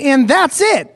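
high, emphatic sign-off; exclaimed. Acted. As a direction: say it with an audible exclamation point. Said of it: "Exclamation point".

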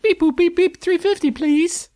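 high beeping robot voice. Pretend. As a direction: chirp it like a machine. Voicing a payment app message.

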